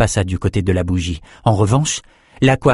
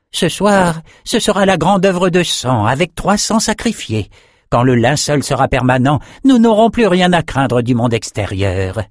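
high, grandiose sorcerer's voice; exalted André; 7 semitones higher than usual. A narrator's reading. André announces tonight's great blood work, high and grandiose. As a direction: proclaim the great work in a raised, eager voice.

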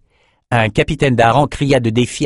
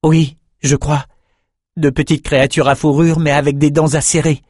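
Oliver's voice; 5 semitones higher than usual. A narrator's reading. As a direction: feel it out hesitantly. Oliver senses the rats.

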